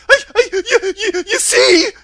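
loud, high whimper, stammering; blubbering, comically hammed-up whimper; Cowardly Lion. A narrator's reading. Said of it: "said the Cowardly Lion with a whimper".

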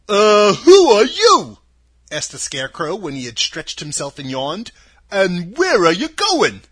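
booming friendly questions; scarecrow voice. Acted. Scarecrow asks.

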